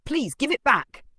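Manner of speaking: high, loud pleading cry; desperate plea. Acted. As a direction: beg for it back, frantic. Desperate woman pleads for box.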